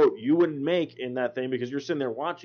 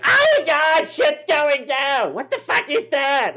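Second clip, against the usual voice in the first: much higher, louder, drawn-out shriek of a loud moviegoer; loud obnoxious woman; 13 semitones higher, 7 dB louder, much slower than usual. Retold or imitated. Imitating the loud woman behind him at the movie, a high, loud, drawn-out squawk. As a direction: blare it like the most annoying person in the theater. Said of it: "this loud, obnoxious".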